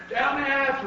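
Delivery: high-pitched echoing cheer; crew's shout. A narrator's reading. Crew answering captain at dinner, high shout. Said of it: "we all shouted".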